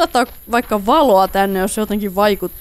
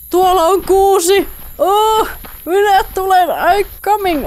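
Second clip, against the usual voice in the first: high, bright-eyed rush toward the tree; eagerly calling out that he's coming; excited; 9 semitones higher than usual. Real, not acted. Spots spruce, hurries over.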